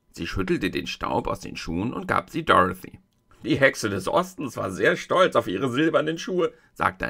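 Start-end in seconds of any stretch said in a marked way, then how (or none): none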